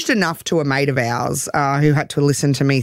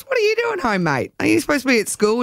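put-on high, surprised mate-to-kid question; a startled bloke catching a truant kid; surprised call; 8 semitones higher than usual. Simon surprised to find a kid at home. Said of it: "And Simon goes".

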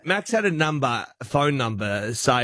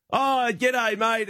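mock cheery g'day; 8 semitones higher, much slower than usual. Prank call greeting, high voice.

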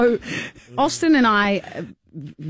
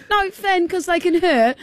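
high worried plea; mock scared pleading; 10 semitones higher than usual. [name] begging Finn to stop, high plea. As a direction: plead with a nervous edge. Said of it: "I was going".

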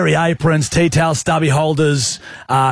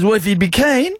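higher, casual invite in a friend's voice; Smithy impression; 6 semitones higher than usual. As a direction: imitate the mate asking. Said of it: "Smith Tech impression".

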